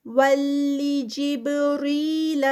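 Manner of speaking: slow, drawn-out tajweed recitation; Quranic recitation. Shown as a demonstration. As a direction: chant it slowly, stretching each sound. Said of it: "با کا قلقلہ". (آہستہ کھینچ کر تلاوت)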